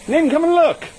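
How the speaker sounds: high loud call across the garden. Real, not acted. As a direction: shout for her to come look. Calling wife to see snake.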